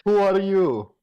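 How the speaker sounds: high, loud demanding question; mock demand. Player character demanding guards' names, high. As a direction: demand their name. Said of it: "Oh, yeah".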